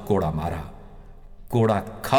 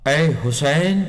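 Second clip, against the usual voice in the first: raised, higher calling voice; loud call; 6 semitones higher, 5 dB louder, slower than usual. A narrator's reading. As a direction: call out to him loudly, pitched up. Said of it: "بلند آواز میں پکار کر کہا". (بلند آواز میں پکار کر)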